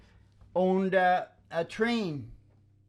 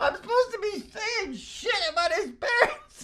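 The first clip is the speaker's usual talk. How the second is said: giggling through the words, pitched up; laughing. Real, not acted. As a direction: laugh as you admit it. Laughing while recalling the task.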